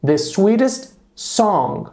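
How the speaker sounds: slow, careful model sentence; slow model phrase. Shown as a demonstration. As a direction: say it slowly so students can repeat. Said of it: "qual é o quê?". (frase modelo dita bem devagar)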